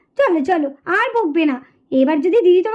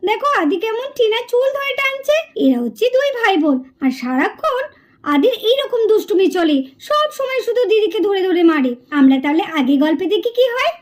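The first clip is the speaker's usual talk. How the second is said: put-on lower, even storyteller voice introducing the children; story narration; 3 semitones lower than usual. A narrator's reading. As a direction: calmly present the characters to young listeners. (শান্ত গল্প বলার ভঙ্গি)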